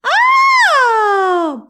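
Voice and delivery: a loud, high burst of surprise; surprised oh. Real, not acted. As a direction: blurt it out, caught off guard. Reacting to the author's name.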